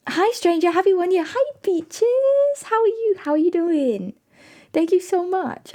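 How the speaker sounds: high squealy hello; excited greeting. Real, not acted. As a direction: greet them with a delighted high voice.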